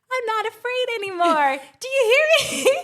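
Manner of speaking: hammed-up child yelling his courage; Home Alone kid. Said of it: "quoting things like Home Alone when he's like".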